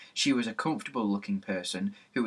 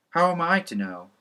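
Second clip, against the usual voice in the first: snappish, higher and louder; impatient; 7 semitones higher, 4 dB louder than usual. A narrator's reading. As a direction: brush off the question. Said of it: "said Mister Hick impatiently".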